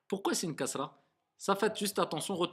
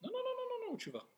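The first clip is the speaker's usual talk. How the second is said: high protest; 13 dB quieter, faster than usual. Real, not acted. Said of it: "stopping student's mistake".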